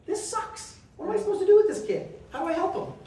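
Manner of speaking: high, frantic what-do-I-do; airy then loud, anxious self-talk; exasperated inner voice. Retold or imitated. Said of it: "And I'm like".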